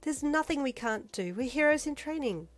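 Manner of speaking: high, upbeat and cheerful; brightly. A narrator's reading. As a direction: say it brightly, full of hope. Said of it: "she said brightly".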